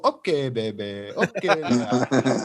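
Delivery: put-on slow, lilting Farsi accent copy; dad copying the nurse's Farsi lullaby accent; imitating sing-song Farsi accent. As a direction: coo it slowly like the Iranian nurse. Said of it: "I used to go".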